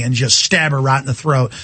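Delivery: normal talking voice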